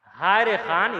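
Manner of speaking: normal talking voice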